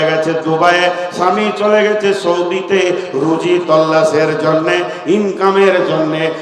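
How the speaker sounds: loud sing-song sermon chant; full-force preaching. Acted. (উচ্চস্বরে সুরেলা ওয়াজ)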